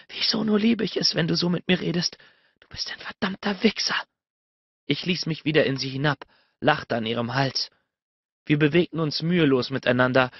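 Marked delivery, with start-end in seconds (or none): 0.1-4.0 high voice fading into a whisper; hissed. A narrator's reading. Woman half-scolding her lover for his talk, hissing. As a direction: start high, end in a whispered insult. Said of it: "zischte sie zwischen den Zähnen hervor".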